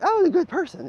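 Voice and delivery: high, squeaky mock self-praise; sarcastic falsetto. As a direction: squeak it like a mocking caricature. Mocking a self-excuse.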